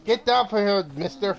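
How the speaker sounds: playful shouted scolding; mock scolding yell. Real, not acted. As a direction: yell at him like a cross parent. Player yelling at game enemy.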